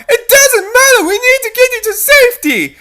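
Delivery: high, loud insistent reply; urgent shout. Acted. Rescuer shouts while pulling her to safety. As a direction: shout over your shoulder as you run.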